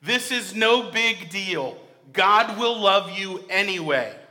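loud, emphatic pulpit preaching; a pastor thundering the devil's lie; preaching at full force. Acted. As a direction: drive each phrase home to the congregation. Preacher voices evil's whisper loudly.

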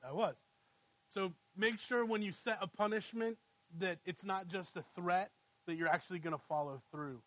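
ordinary delivery

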